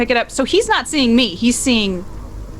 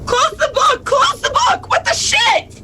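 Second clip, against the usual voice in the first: much higher shouting in panic; panicked shout; 12 semitones higher than usual. Acted. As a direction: scream at them to close it.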